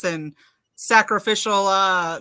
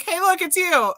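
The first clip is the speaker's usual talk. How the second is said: laughing exclaim; 10 semitones higher than usual. Retold or imitated. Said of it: "And I was like".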